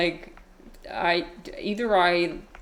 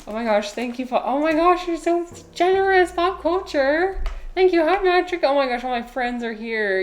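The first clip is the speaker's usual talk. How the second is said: high, delighted squeal of thanks; gushing excitement; 9 semitones higher than usual. Real, not acted. Streamer thanks generous viewers as friends arrive, high excited gushing. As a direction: gush with thanks, pitch way up.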